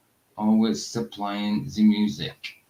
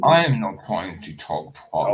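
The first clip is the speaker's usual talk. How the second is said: slow, put-on posh English denial; 3 semitones lower than usual. Partner asks why, he denies in mild posh English. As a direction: protest in a lightly posh English accent. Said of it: "talk like a posh English".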